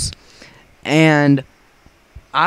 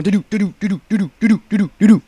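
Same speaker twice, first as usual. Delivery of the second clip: higher doo-doo for a racing heart; pounding heartbeat; 6 semitones higher, 3 dB louder, much faster than usual. Retold or imitated. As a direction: hum your heart hammering in panic. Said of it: "my heart is literally going".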